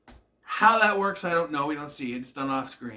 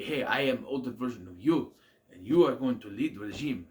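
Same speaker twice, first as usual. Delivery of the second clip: put-on Russian accent; 4 semitones lower than usual. Boris voiced in a recap.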